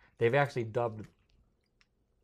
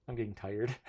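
usual speaking voice

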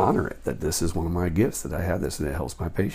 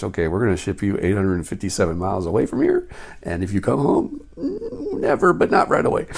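mock official voice; 6 dB louder than usual. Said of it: "They were pretty much".

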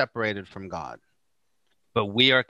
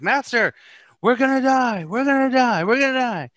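high, loud mock panic, repeating; hammed-up cry of doom in a storm; panicked apostles; 11 semitones higher, 6 dB louder than usual. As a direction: wail it like terrified sailors.

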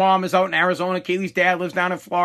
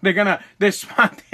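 ordinary talk

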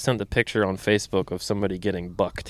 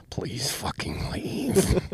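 slow fed-up plea; 3 semitones higher, 6 dB quieter, much slower than usual. Retold or imitated. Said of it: "it's just kinda like".

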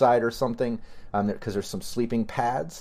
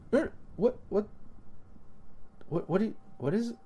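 confused; 7 semitones higher, 6 dB quieter, faster than usual. Acted. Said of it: "looks confused".

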